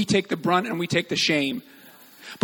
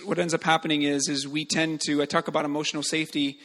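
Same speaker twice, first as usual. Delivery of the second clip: ordinary talk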